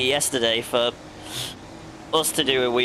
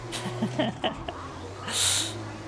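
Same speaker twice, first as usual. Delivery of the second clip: soft laugh; 8 semitones higher, 9 dB quieter than usual. Real, not acted. Laughs at pirate voice joke.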